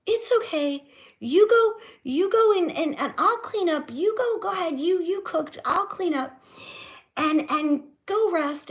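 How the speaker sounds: hammed-up sweet offer to clean up; mimicking mom. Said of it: "my mom would be like".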